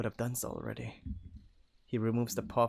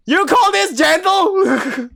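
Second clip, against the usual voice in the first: very high, loud, drawn-out retort; outraged shout; 19 dB louder, much slower than usual. Acted. Wolf rebukes companion over being gentle, outraged scream. Acted quarrel over brutalized kid.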